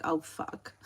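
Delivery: low, hushed mock dread; retold dismay. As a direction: swear under your breath, imitating your own dismay. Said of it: "And I would be like".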